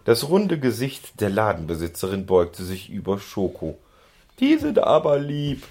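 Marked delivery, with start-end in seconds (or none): 4.4-5.6 high, louder doting coo. A narrator's reading. Shopkeeper bending over the ponies, cooing high. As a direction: gush sweetly over cute animals. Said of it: "säuselte sie".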